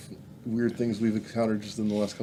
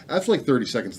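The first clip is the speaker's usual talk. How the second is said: ordinary talk, nothing marked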